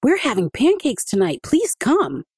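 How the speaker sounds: put-on high, eager boy spilling the news; blurted out. A narrator's reading. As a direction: blurt out the invitation like an excited child. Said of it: "Adika blurted out".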